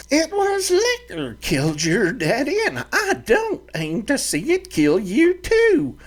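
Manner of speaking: put-on high, fiery old ranch mother; stern mother's threat, pitched high; scolding mother. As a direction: scold your grown boy like a fiery mama. Mother lectures son.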